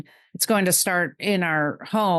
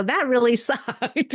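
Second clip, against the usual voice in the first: laughing through it; 5 semitones higher than usual. Retold or imitated. Kids' quote, laughing mid-word.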